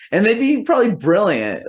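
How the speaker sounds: ordinary delivery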